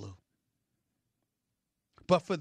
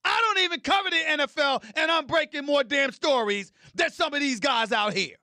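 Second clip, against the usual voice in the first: loud, high-pitched, boastful tirade; indignant shout; 10 semitones higher, 7 dB louder than usual. Real, not acted.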